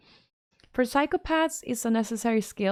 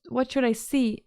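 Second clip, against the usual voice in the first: normal talking voice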